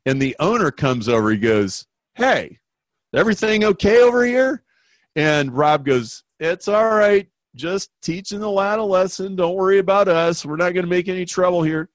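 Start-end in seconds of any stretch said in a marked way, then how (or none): 2.2-4.6 high, quick owner's check-in; raised-pitch, hurried "everything okay" from the owner; concerned club owner. Retold or imitated. 6.4-11.9 higher, easygoing tough-guy voice; a lightly voiced Rob, quick at the end; smooth Rob. Rob reassures the owner.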